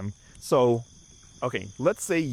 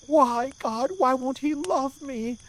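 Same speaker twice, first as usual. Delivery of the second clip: higher, slow mock wail to God; mock sobbing wail; slower than usual. Heartbroken stranger crying against a tree, mock wail. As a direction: ham up a heartbroken sob.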